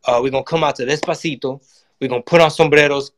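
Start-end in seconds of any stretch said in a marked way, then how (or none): none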